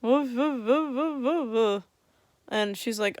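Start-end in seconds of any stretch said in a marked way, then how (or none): 0.0-1.8 high, loud, woof-like numb babble; garbled numb-mouth noises. Retold or imitated. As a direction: yelp nonsense through a numb mouth. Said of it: "I'm like".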